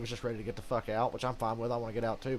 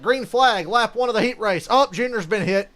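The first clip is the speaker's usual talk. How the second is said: much higher, loud announcer call; mock race announcer; 10 semitones higher, 13 dB louder than usual. Mocking the race broadcast, a loud high announcer call. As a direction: hype it like a TV race caller. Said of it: "it was like".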